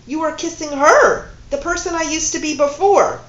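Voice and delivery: loud, high indignant accusation, stress on her; accusing outburst. Acted. Woman confronting her lover, loud indignant accusation. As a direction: lash out at him.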